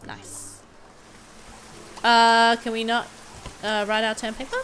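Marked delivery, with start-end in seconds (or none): 0.1-0.6 a soft whispered nice. Real, not acted. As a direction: breathe it out in a whisper. Quiet aside in gameplay.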